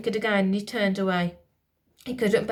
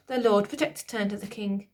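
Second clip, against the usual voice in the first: ordinary delivery, nothing marked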